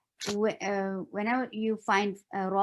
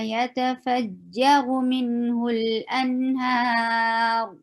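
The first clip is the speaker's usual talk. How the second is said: chanted, melodic recitation; Quran recitation; 2 semitones higher, 4 dB louder than usual. Shown as a demonstration. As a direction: intone the verse with care. Begins recitation of verse.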